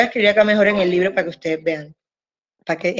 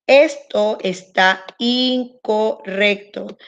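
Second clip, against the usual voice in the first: slow emphatic rule; slower than usual. Shown as a demonstration. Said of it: "stressing the rule slowly".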